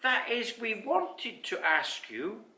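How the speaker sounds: high, hesitant little creature; high voice slowing nervously at the end; Basil. A narrator's reading. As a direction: fumble towards a request. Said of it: "said Basil".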